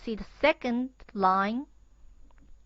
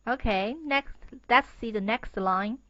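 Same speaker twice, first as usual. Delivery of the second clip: ordinary delivery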